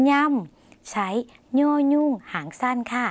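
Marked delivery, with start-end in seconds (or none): 0.1-0.9 ย่ำ higher, with a nasal ny onset; nasal ny sound. Shown as a demonstration. Teacher models the pronunciation for viewers, nasal ny in ย่ำ. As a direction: lift ย่ำ and start it in the nose as ny. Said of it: "ออกเสียงว่า".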